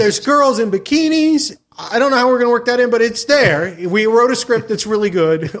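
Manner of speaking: high, hurried salesman patter; eager pitch. Acted. Host selling a half-baked script, eager pitch. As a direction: keep piling on selling points. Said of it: "run around town with a script in your hand going".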